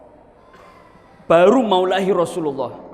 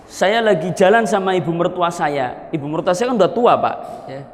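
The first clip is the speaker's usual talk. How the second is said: usual speaking voice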